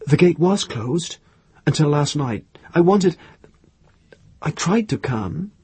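narrator's hesitant Irene, breaking off to restart; groping for words. A narrator's reading. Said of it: "She tried to get the right words".

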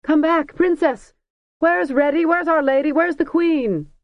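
high, loud calls, the last one slowed and stretched; wild calling. A narrator's reading. Crowd calling for the hidden princess, wild high calls. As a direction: call after her as she vanishes. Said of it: "cheered and called more wildly than ever".

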